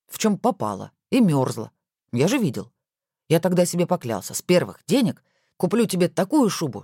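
ordinary delivery

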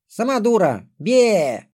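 высокий задиристый детский голос; подразни сестру, как ребёнок; детская дразнилка. A narrator's reading. Дети дразнят сестру из-за угла. (дразнящим детским голоском)

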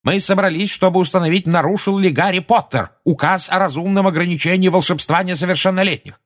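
loud, high official declaration; indignant minister laying down the agenda; heated insistence. A narrator's reading.

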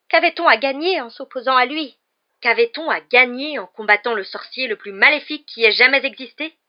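loud, high-pitched raging retort; terrible fury. A narrator's reading. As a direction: throw his question back in rage. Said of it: "animé d'une terrible fureur". (fureur terrible)

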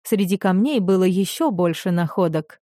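usual speaking voice

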